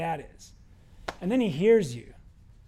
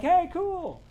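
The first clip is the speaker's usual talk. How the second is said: high-pitched, casual "good for you"; mock cheery reaction; 11 semitones higher, 3 dB louder than usual. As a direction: toss it out high and upbeat. Acted reaction to someone's good news.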